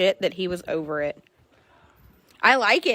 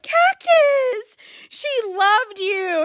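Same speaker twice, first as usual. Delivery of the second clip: higher, slow crying plea; mock crying plea; 12 semitones higher, 3 dB louder, much slower than usual. Owner pleads with Cactus about his old owner, mock crying. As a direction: beg the horse through mock tears. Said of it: "And I'm like".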